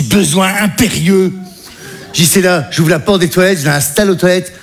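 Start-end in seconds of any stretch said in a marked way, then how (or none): 0.0-1.3 slow correction. Shown as a demonstration. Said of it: "on dit".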